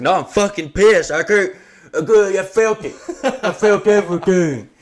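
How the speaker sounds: loud, high slurred complaint through a bandage; imitating a drugged friend ranting; furious doped-up rant. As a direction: yell it groggy and outraged.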